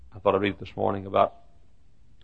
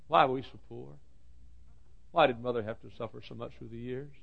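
soft, subdued questioning; hushed lament; 12 dB quieter than usual. Acted. Preacher asking God about his family's suffering, hushed lament. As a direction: drop to a quiet, wounded plea.

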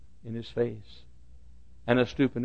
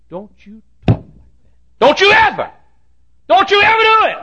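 scolding shout; 14 dB louder than usual. Retold or imitated. Preacher rebuking a gossiping colleague, scolding shout. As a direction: rebuke building to a high, loud shout.

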